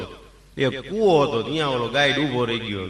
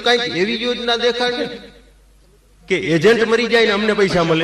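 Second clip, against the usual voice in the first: punchline; 7 semitones higher, 5 dB louder than usual. Retold or imitated. Punchline to insurance agent.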